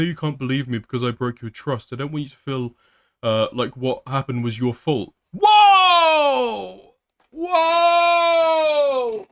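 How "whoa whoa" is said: loud, high startled shout; shocked whoa. Real, not acted. Streamer reacting to a shocking message, loud and high. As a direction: jolt back at the screen.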